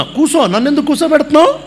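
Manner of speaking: put-on high, quick puzzled child. Boy at the clinic asking grandpa why he must sit, puzzled and high. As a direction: ask it like a confused little boy. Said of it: "అన్నట్టు చూస్తున్నా".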